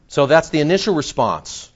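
usual speaking voice

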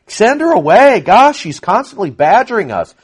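high, whiny complaint; mock-annoyed apostles begging to be rid of her; exasperated disciples. Apostles complaining to Jesus about the woman, whiny and high. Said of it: "the apostles start going".

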